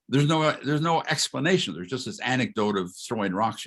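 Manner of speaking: usual speaking voice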